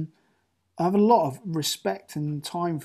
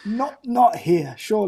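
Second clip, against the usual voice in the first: slow, higher, louder playful denial; mock disbelief; 5 semitones higher, 5 dB louder than usual. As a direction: draw it out in teasing disbelief. Mock dismay at accent question.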